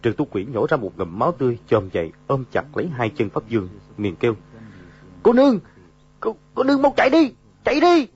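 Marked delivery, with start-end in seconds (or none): 5.2-8.1 high, loud urgent cry; desperate shout. A narrator's reading. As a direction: yell at her to run. Dying man urges her to flee.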